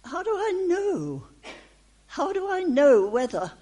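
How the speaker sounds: a hint of a puzzled questioner; questioning believer. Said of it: "You're saying".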